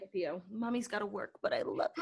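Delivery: tender. Pretend. Tender mom voice to dog.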